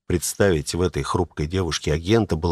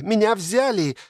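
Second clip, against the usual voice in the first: second time much higher and squealing; 14 semitones higher than usual. A narrator's reading. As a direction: shout it again, even more thrilled. (ещё выше, с визгом)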